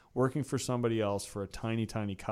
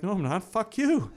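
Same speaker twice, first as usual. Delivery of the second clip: sharp, higher mock-defiant snap; defiant retort; 10 semitones higher, 5 dB louder than usual. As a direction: tell the boss off with a raised voice. Defiant reply to boss, retold.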